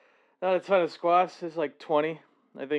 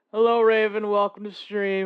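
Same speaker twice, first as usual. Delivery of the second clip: excited greeting; 6 semitones higher, 5 dB louder than usual. Real, not acted. Greeting a chat viewer.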